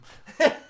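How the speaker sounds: sudden high laugh; a real amused laugh; burst of laughter. Real, not acted. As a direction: laugh out loud at the memory.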